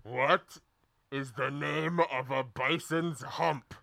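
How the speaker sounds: slow, low, quiet villain question; ominous hooded stranger. Acted. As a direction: ask it darkly and slowly.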